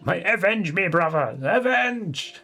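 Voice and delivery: high, rising mock-dramatic cry for vengeance; hammed-up battle cry. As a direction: cry out like a dying warrior, over the top. Hams up the letter's final plea.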